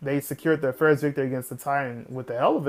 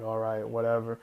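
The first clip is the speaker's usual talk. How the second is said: softer, lower, resigned shrug of a line; calmed resignation; 6 semitones lower, 7 dB quieter than usual. Retold or imitated. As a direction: let it go quietly, giving up. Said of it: "she recollects herself".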